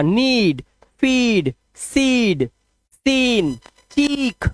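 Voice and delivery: नीड, फीड, सीड, सीन, चीक each with a drawn-out "ee"; word list from नीड to चीक, each "ee" stretched; long vowel demo. Shown as a demonstration. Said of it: "long sound देना होगा".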